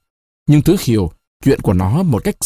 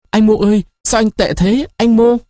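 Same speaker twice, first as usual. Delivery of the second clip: near tears; 9 semitones higher than usual. A narrator's reading. Lover calling him as she breaks into tears, tearful high plea. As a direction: call out to him as if starting to weep. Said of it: "Người nhân tình phát khóc".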